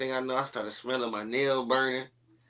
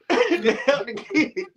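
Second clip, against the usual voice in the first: high, laughing words; high, giggling retelling; laughing while telling; 12 semitones higher than usual. Real, not acted. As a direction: laugh it out, slowing.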